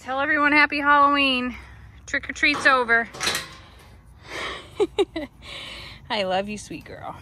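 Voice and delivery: normal talking voice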